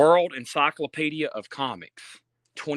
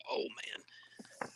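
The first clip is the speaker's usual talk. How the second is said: whispered; 19 dB quieter than usual. Real, not acted. Quiet awe at a book.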